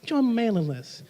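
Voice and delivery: softly voiced creepy invitation; a hint of a creepy stranger leaning in; soft creepy coaxing. Speaker mocks sign-up forms, soft creepy coaxing. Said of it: "A little creepy".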